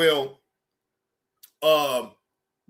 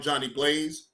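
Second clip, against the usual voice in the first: ordinary talk, nothing marked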